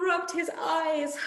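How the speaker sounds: lively storybook reading voice; reading to children. A narrator's reading. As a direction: read it out like a picture book for little ones. Reading a picture book aloud to children.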